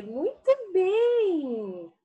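very slow, high Muito bem; teacher praising the class; praise. Acted. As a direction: praise the children warmly.

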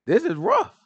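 sudden high squeak of mock dismay. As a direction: jump way up for a comic groan. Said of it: "So he looking like".

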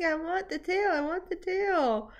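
high, laughing cat voice; voicing the cat. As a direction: squeak it like a greedy kitten. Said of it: "He's like".

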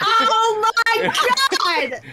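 high, very drawn-out exclamation; long, high-pitched cry of exasperation; laughing disbelief. Real, not acted. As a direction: stretch out each word in laughing horror. Player reacts to the iceberg cry.

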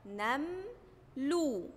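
slow "نملو", split as nam, then lu; syllable by syllable. Shown as a demonstration. Teacher gives fatha example, "نملو" slowly split into syllables. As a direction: hold "نم", pause, then drop onto "لو". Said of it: "النون عليها فتحة زي كلمة".

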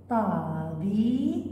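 طبي held very long; very slow طبي; slow syllable. Shown as a demonstration. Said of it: "يصبحان مقطع واحد،".

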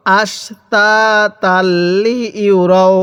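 very slow, drawn-out syllables; model recitation. Shown as a demonstration. Teacher models Quran words for learners, slow measured recitation. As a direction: stretch each long vowel evenly. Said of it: "এরকমভাবে শিখব".

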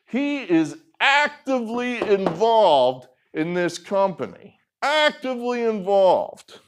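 "actively involved" with rising outrage; emphatic. Real, not acted. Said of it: "this is significant".

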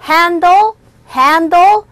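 "handle" high and loud, separated; "handle" said as an isolated, emphatic drill word; pronunciation drill. Shown as a demonstration. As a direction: model "handle" slowly with a lifted ending. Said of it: "발음이 I will 축약되어져서 우리가 발음 공부했던".

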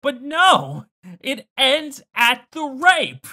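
loud, high, slow lament; exasperated outrage. Real, not acted. Viewer finishing a rant on the ending, loud exasperated outrage. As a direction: hammer each word in drawn-out indignation.

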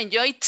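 "enjoyed" ending in a crisp T sound; wrong T ending. Shown as a demonstration. Teacher showing students a wrong option, "enjoyed" ending in T. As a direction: say "enjoyed" as en-JOYT, with a hard T. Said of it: "tiene que sonar el t".

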